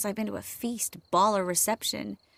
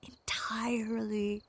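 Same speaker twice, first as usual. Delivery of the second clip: teasing whisper; 7 dB quieter, slower than usual. Acted. Building suspense about the statues.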